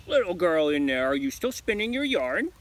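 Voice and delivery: loud calling witch voice. Acted. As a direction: call through the door like Baba Yaga. Witch calls through door.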